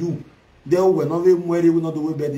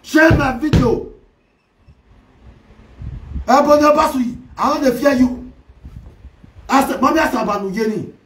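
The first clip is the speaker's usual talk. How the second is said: loud challenge; 7 semitones higher, 5 dB louder than usual. Real, not acted. Vlogger shouting a challenge at a rival, loud. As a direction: shout it out, daring them.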